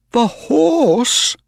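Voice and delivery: high, incredulous question; flabbergasted exclamation; astonished cry. A narrator's reading. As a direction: cry out in disbelief, pitched high. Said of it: "cried Mister Ross".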